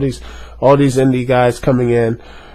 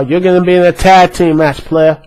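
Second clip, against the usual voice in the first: lightly imitated match-maker, a bit louder; higher, punchy mock announcement; Theodore Long; 5 semitones higher, 5 dB louder than usual. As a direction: announce the match in a mildly hyped voice. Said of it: "no one saying".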